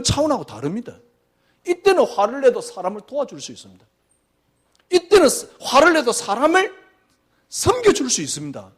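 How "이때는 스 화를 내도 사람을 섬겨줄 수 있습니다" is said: high, loud restatement; soaring emphasis. Real, not acted. Pastor restating to the congregation, high and loud. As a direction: lift it up to make the point.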